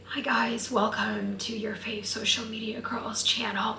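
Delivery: breathy, cracked voice barely getting out; hoarse whisper. Shown as a demonstration. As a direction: rasp it out like you've lost your voice. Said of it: "it sounds like".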